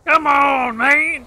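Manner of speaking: high loud exasperated shout; frustrated yell. Real, not acted. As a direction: shout it at the screen. Yelling after getting hit.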